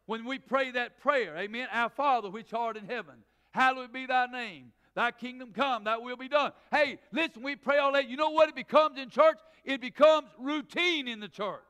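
loud, rising preacher's cadence; full-voiced sermon delivery; preaching at full force. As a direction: drive it home to the pews. Preaching to congregation.

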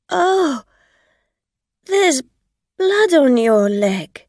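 high, loud horrified gasp; shocked. A narrator's reading. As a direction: recoil at the sight of blood. Driver sees blood, shocked.

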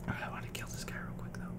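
hushed scheming whisper; sneaky whispered plan. Real, not acted. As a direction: breathe it under your voice. Plotting quietly while waiting.

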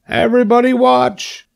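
high pig shout; excited cry. A narrator's reading. Pig announces his jump to watchers, excited cry. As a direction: crow it out to an audience. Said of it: "he cried".

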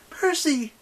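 high, loud shout of warning; urgent warning. A narrator's reading. Annabeth warns Percy as the doors slide apart, urgently. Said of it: "she warned".

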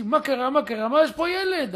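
high, loud startled yell; alarmed shout. Retold or imitated. Technician finds a child in the elevator and shouts in alarm. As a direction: cry out in alarm. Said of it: "צעק".

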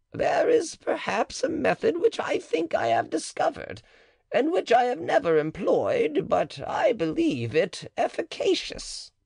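half-confess a secret method; high-pitched cautious midwife; the matron. Acted. Matron voiced in the dialogue.

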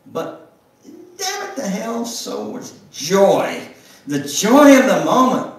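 swelling high and loud with defiance; defiant joy rising to a loud, high cry. A narrator's reading. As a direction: let the joy burst out against death. Narration rises to defiant joy.

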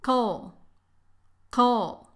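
Coal as a short ko; first syllable Coal. Shown as a demonstration. Teacher breaking chocolate down, short Coal. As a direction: clip the o in Coal. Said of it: "Aquí la O casi no tiene sonido".

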